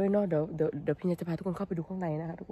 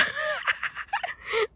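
high laugh; 5 dB quieter than usual. Real, not acted. Laughing at the haul.

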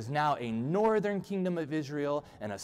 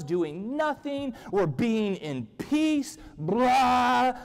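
high, drawn-out mock royal rant; hammed-up yelling, stretching words in exasperation; worked-up yell; 7 semitones higher, 4 dB louder, slower than usual. Bored king rants about peace to his people, yelling. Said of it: "he yells".

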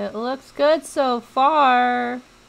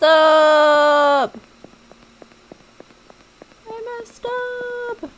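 slow, drawn-out groan of regret; frustrated wail; 5 semitones higher, 5 dB louder, much slower than usual. Real, not acted. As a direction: wail it out in despair.

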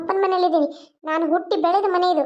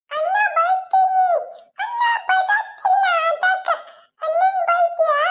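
put-on high, slow, tearful child wail; child crying; 12 semitones higher, slower than usual. Little girl crying that her brother won't play.

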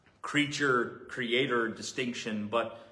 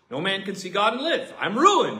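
fast, louder anguished voicing; Isaiah's cry; 7 semitones higher, 9 dB louder than usual. Isaiah despairing after seeing God, louder anguished cry. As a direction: cry out that you are ruined.

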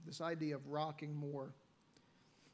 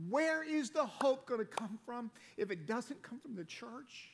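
preaching; 4 semitones higher than usual. Acted. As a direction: build it like a pulpit appeal. Preaching to congregation.

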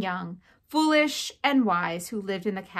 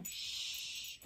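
long, very quiet hushing sound; soft shush; 18 dB quieter than usual. Real, not acted. Mother quieting child during a class reading, soft shushing.

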